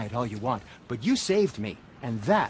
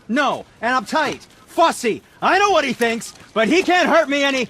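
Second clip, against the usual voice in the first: rising from sharp to loud and higher; building angry rant; 11 semitones higher, 8 dB louder than usual. Acted. Smith venting about his brother's insults, building to a loud, high rant. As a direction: let the hurt boil over into a yell.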